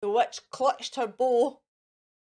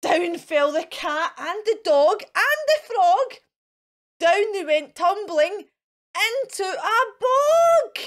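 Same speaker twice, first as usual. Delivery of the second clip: loud, higher excited narration; dramatic falling; 6 semitones higher, 7 dB louder than usual. A narrator's reading. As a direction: build up the tumble. Animals tumble into bog.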